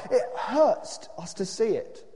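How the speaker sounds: slow, high-pitched complaint; drawn-out cry of protest. A narrator's reading. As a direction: cry it out, dragging the words. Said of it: "they cried".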